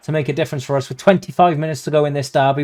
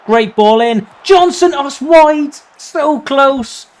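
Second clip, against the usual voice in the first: high, loud cry as the shot goes wide; excited near miss; 11 semitones higher, 6 dB louder, slower than usual. Real, not acted. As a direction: shout the chance, then groan.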